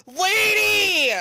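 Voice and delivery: high, loud drawn-out yell; exasperated shout. Real, not acted. Shop owner yells at a customer, exasperated shout. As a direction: stretch it into a high holler.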